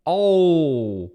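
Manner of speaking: ordinary talk, nothing marked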